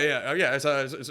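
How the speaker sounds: ordinary delivery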